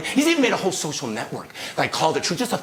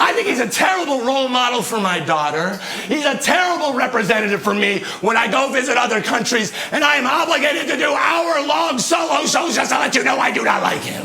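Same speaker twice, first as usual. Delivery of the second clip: high, insistent declaration; indignant rant; 6 semitones higher than usual. Real, not acted. Comic tells the audience he dislikes the politician, indignant high rant. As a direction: press each complaint home in a raised pitch.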